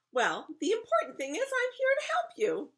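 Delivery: very high, loud fairy-godmother voice; high-pitched storybook helper; little man character. Acted. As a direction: declare your help eagerly. Little man offers help.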